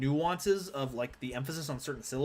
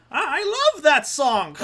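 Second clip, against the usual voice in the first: much higher and louder, slow burst of delight; excited shout; 13 semitones higher, 10 dB louder, slower than usual. Real, not acted. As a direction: blurt it out thrilled. Excited outburst about song.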